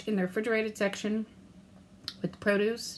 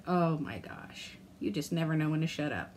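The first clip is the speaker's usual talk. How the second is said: lower, quieter grumbling inner voice; 6 semitones lower, 6 dB quieter than usual. Retold or imitated. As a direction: grumble like a nagging conscience. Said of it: "my inner voice".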